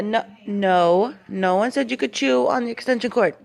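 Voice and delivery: loud, high scolding of a puppy; sharp no to a dog chewing the cord. Real, not acted. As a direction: owner scolding the puppy loudly.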